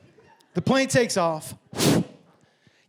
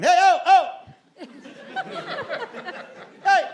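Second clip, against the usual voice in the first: calling out; 9 semitones higher than usual. Retold or imitated. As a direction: shout to get someone's attention. Calling to man wearing earbuds.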